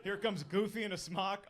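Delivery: usual speaking voice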